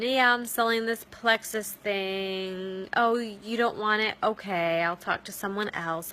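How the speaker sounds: mock a bored seller, dragging the words out; dull and boring. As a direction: drone it with zero enthusiasm, trailing off. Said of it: "you're dull and you're boring".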